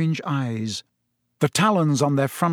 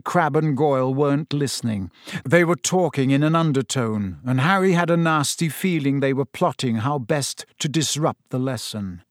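ordinary delivery

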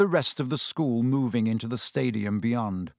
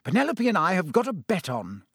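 hearty; 6 semitones higher than usual. A narrator's reading. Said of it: "said Percy heartily".

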